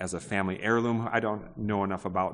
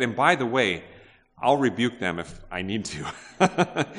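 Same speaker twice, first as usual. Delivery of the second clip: a louder, higher, playful mock rebuke with a laugh; mock Jesus; 3 semitones higher, 5 dB louder than usual. Preacher jokes about Jesus rebuking the disciples, playfully loud. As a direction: ham up a stern master, half laughing. Jokingly voices what Jesus might say.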